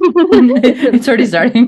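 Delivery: laughing through the words; amused, laughing it out. Real, not acted. Laughing at her own breathlessness.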